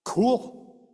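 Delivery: high, loud and triumphant; preacher celebrating his father's prayer, elated; exultant cool. Retold or imitated. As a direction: punch it with delight. Exultant reaction after the prayer.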